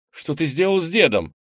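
high, loud scared cry; frightened shout. Acted. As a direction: demand an answer in panic. Boy shouts in fear.

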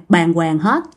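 ordinary delivery, nothing marked

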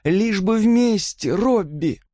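high, slow, yearning entreaty; breathed-out plea. A narrator's reading. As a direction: draw the words out as you beg. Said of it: "выдохнула она". (протяжная мольба высоким голосом)

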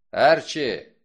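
loud sharp call across room; shouting a name. Acted. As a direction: bark the name out. Wolfe calls out sharply.

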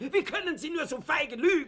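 high, outraged cry; furious accusation. Acted. Fuchs accuses the doctor of cowardly lying, high and outraged. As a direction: hurl the accusation at him.

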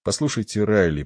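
ordinary delivery

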